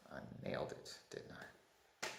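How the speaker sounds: near-whisper, trailing away very soft; hushed aside. Real, not acted. Host privately doubting the accent attempt, hushed aside. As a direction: drop to a tiny, barely voiced murmur.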